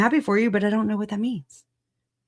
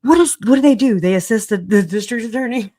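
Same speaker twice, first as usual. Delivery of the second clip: mock clueless; 5 semitones higher, 6 dB louder than usual. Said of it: "I'm just kidding".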